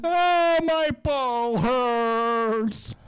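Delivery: very high, loud, very slow whine of pain; a big lion whining like a baby; hammed-up whimper. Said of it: "lion said".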